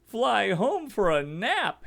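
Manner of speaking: loud, high, slow squeal of pain; squealing eagle. A narrator's reading. Eagle squealing as the fire singes him. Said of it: "he squealed".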